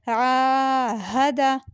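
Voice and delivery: आहदा with a long drawn आ, very slow; long drawn Ayn. Shown as a demonstration. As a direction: stretch the खड़ा ज़बर in आहदा. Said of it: "आइन खड़ा ज़बर आ". (आहदा में आ लंबा खींचकर)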